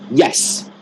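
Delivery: short, definite 'yes'; crisp, sure yes; understanding. Acted. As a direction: answer as if you fully understand. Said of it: "they did understand".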